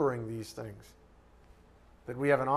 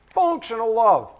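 "Functional love" belted out, much higher and louder; big emphasis; 7 semitones higher, 9 dB louder than usual. Shown as a demonstration. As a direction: hammer "Functional love" with force. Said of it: "said it with that kind of emphasis".

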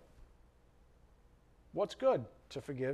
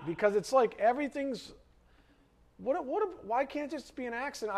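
high, loud exasperated outburst; 9 semitones higher, 4 dB louder than usual. Real, not acted. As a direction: throw up your hands in disbelief. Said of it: "I'm getting angry".

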